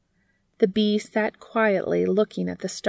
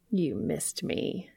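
teasing; 3 semitones lower, 8 dB quieter, slower than usual. A narrator's reading. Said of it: "teasing him".

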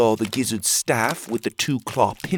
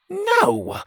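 very high No; sharp refusal. A narrator's reading. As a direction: snap back at once. Pockets refuses.

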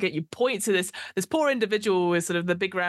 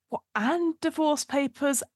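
exasperated undead; 8 semitones higher, slower than usual. Acted. Second undead complaining in queue.

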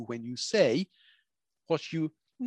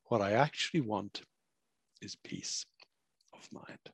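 wistful quote; 3 semitones lower, 6 dB quieter than usual. Retold or imitated. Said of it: "people keep saying to me".

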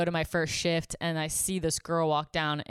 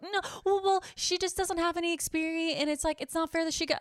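high-pitched mocking voice for the other girls; mock their defensive excuses up high; imitated coworkers; 12 semitones higher than usual. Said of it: "They're all like".

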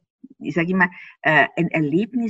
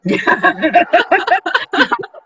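loud laugh; 11 semitones higher, 6 dB louder than usual. Real, not acted. Austrian guest laughing at her own prejudice, loud laugh. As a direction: burst of high laughter.

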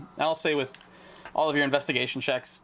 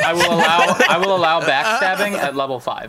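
laughing; 3 semitones higher, 10 dB louder than usual. Real, not acted. GM laughing at backstabbing idea.

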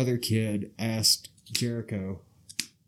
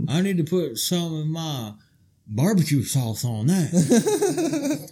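slow hammy drawl; 4 semitones higher, slower than usual. Retold or imitated. Joking about Jim Ross's sauce.

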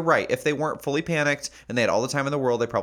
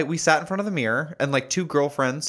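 normal talking voice

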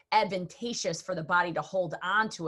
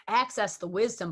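normal talking voice

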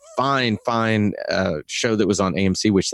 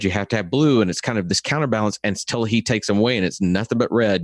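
ordinary talk, nothing marked

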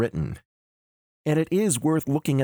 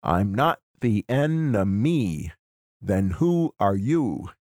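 slow scansion, stress on "not", "who" and "you"; measured da-DUM rhythm, leaning on "not" and "who"; iambic stresses; 3 semitones lower, slower than usual. Shown as a demonstration. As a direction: beat out "enemy" syllable by syllable. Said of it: "is iambic".

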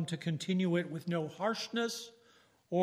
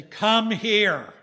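put-on loud firm call to a child; command to child; 2 semitones higher, 9 dB louder than usual. Shown as a demonstration. A father calling his child over, loud firm command. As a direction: bark it out like calling a kid over. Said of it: "it's got a much different tone".

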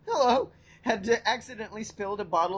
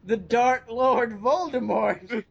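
dramatic proclamation; 2 semitones higher, 3 dB louder, slower than usual. Acted. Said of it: "dramatic title proclaimed".

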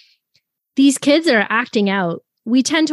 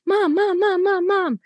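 higher pitched, nagging "mom" over and over; whining child; 8 semitones higher than usual. Retold or imitated. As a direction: play the kid tugging at a parent's sleeve. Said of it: "this screaming child that's just whining".

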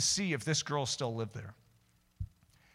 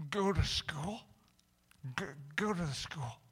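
soft, halting, timid request; small hesitant voice, feeling for the words; imitating the girl; 7 dB quieter than usual. Girl at lunch asking to visit school, halting. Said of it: "And what does she say?".

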